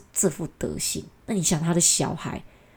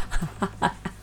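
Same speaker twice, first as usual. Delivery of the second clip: breaking up after own imitation; real laugh; 4 dB quieter than usual. Real, not acted. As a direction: burst of real giggling.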